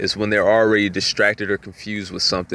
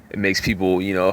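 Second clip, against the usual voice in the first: usual speaking voice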